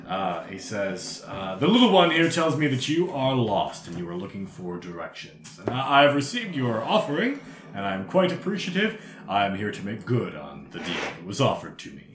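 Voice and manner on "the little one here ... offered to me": formal, swooping gentleman-devil voice; mannered expedition leader, politely businesslike; courtly devil explorer. Acted. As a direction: speak with courteous theatrical pomp.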